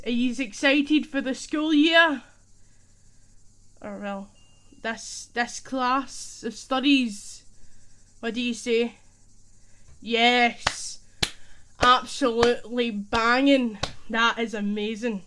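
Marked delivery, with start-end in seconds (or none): 0.0-2.2 loud, raised hype-up question; rally the class with it; big hyped-up teacher call; excited class question. Acted. 10.0-15.2 loud, emphatic Scottish enthusiasm; hyped-up and loud; excited cheer. Acted. Acted excited answer to the question.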